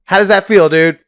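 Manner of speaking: snapping at a man rattling his ice cup; angry retort. Retold or imitated. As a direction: fire it back at him. Retold confrontation in theater.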